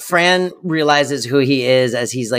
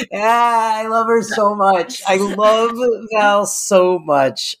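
gushing, stretched out; 6 semitones higher, slower than usual. Real, not acted. Gushing about a character.

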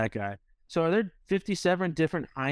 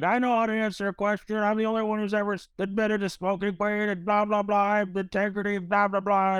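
mock berating; 7 semitones higher than usual. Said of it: "berating me in front of all these kids".

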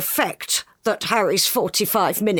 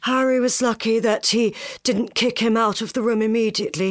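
usual speaking voice

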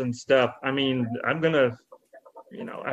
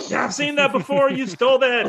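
mock accusing fan; 9 semitones higher than usual. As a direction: play the outraged fan.

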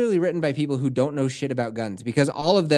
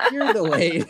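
laughing taunt. Real, not acted. Playful taunting back and forth.

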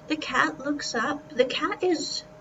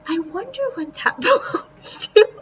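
laughing; 7 semitones higher, 7 dB louder than usual. Real, not acted. Amazed at huge tail.

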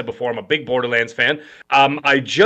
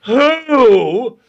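loud, high mock crying; mocking boo-hoo; 9 semitones higher, 5 dB louder than usual. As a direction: sneer it like mock sobbing. Mocking upset fans.

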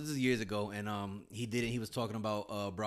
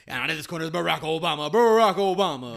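boxing announcer; 5 semitones higher, 14 dB louder than usual. Retold or imitated. Sinbad introducing Obama like a fighter, boxing announcer. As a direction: announce him into the ring. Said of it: "He was like, he goes".

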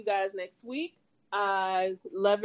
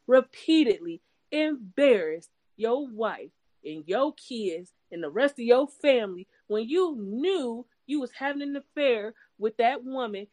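ordinary talk

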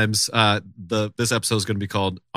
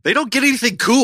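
mocking voice; 12 semitones higher than usual. Mocking boomers.

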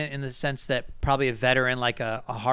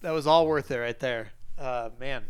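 normal talking voice

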